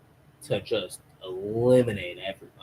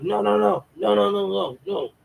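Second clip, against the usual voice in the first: much higher frantic string of no; panicked pleading; 10 semitones higher than usual. Acted. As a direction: beg them to stop, voice up high. Villain panics at the computer.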